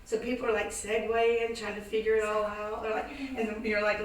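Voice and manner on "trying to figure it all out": normal talking voice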